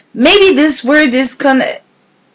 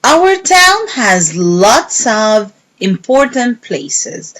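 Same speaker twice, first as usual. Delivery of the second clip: ordinary delivery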